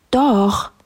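high stubborn retort; insistent princess. A narrator's reading. Princess in a back-and-forth, high and insistent. Princess argues with refusing animals.

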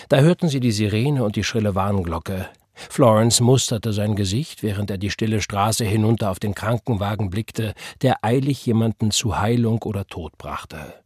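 usual speaking voice

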